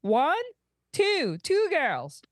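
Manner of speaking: slow, high counting on "two"; counting out slowly. Acted. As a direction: spell it out slowly like to a child. He holds up two fingers.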